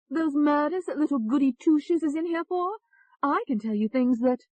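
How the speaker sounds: loud, high, scornful English voice; English accent. A narrator's reading. Alter ego taunting about murders, loud high English accent. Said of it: "said in an English accent".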